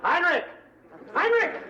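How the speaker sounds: loud call for a man across the house. Acted. Woman calls loudly for Heinrich. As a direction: shout the name out.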